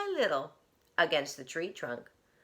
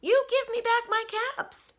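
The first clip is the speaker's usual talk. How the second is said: scolding peddler. Acted. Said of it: "he said".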